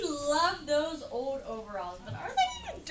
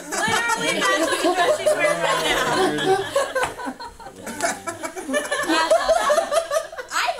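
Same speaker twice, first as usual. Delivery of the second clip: laughing; 9 semitones higher, 12 dB louder, slower than usual. Real, not acted. Laughing at matching dress.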